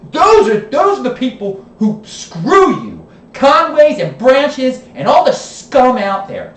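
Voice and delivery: real shouting fury, pitched high and slowed down; emotional outburst. Real, not acted. Vlogger blasting Baltimore's political families, furious shout. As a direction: let the anger boil over at the politicians. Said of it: "Sorry, I'm getting emotional again".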